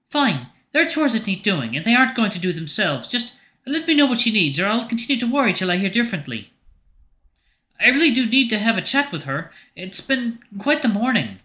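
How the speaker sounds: high-pitched, annoyed character voice; impatient, worried Marion. Acted. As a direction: give in grudgingly in a high voice. Voicing annoyed Marion giving in.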